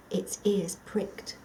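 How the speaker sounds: usual speaking voice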